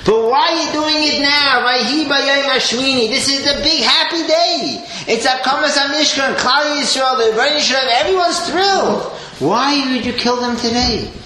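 rising indignation, pitched high; Aaron protesting. Retold or imitated. Aaron pleading with Moshe, high indignant protest. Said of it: "Aaron could have said".

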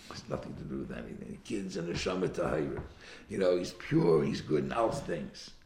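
a wise rabbi gently dismissing the worry; the sage reassuring. Retold or imitated. Great rabbi calming the worried father, low and soft. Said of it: "He says".

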